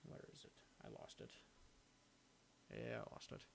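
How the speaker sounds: quiet, half-to-himself search; under-the-breath muttering. Real, not acted. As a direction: mumble it while hunting for something. Searching for lost link, muttering.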